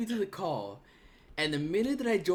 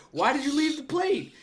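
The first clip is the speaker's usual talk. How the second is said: annoyed complaint; 10 semitones higher, 4 dB louder than usual. Retold or imitated. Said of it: "and, like".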